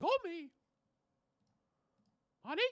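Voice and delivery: high squeaky cartoon mouse; Mickey Mouse. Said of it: "I can't do a very good Mickey".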